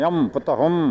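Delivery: எம், புத்தகம் with a long hummed "m"; final consonant shown. Shown as a demonstration. Teacher pointing out the consonant sound to students, held final sound. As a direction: hold the ம் at each end of எம், புத்தகம். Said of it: "அப்ப இம்".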